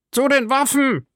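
high-pitched call to arms; crying out. A narrator's reading. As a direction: raise the alarm high, without full volume. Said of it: "schrie Grimaud". (hoher, gespielter Alarmschrei)

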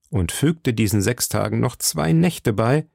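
ordinary delivery

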